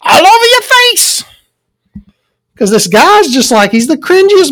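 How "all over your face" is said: much higher, drawn-out mocking squeal; mock the cringey guy in a silly high voice; mock cringe voice. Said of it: "I'm like".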